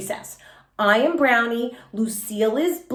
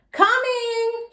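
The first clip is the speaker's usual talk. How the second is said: put-on high girl calling back; shouted reply; 12 semitones higher than usual. A narrator's reading. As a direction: answer the whistle from afar. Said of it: "shouted Yellowy".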